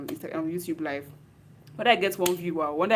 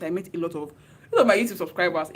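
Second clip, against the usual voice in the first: ordinary talk, nothing marked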